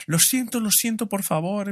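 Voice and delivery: high-pitched mock wail of apology; imitated crying out. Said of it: "empieza a gritar".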